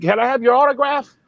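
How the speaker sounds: high, starstruck mock fan voice. Mocking juror asking autograph.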